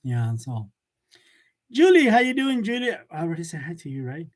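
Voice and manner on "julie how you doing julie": calling out. Real, not acted. Greeting a viewer again.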